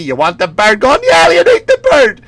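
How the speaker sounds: high, loud Boston taunt; mock yelling. Dad throwing out the Christmas turkey, mock yell. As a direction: climb to a hammed-up yell.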